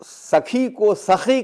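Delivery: سخی said slowly and high, first right, then wrong; mispronunciation demo. Shown as a demonstration. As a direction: stretch out سخی to show the changed sound. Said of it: "تو معنی بدل جائے گا". (سخی کا غلط تلفظ)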